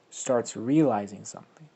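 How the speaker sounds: ordinary delivery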